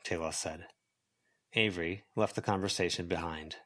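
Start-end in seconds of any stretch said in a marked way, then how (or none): none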